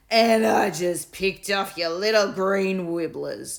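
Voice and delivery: slow, loud taunting bully. A narrator's reading. Bully taunting Will over lost wibblers, slow and loud. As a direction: rub his nose in it. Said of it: "taunted Bartholomew".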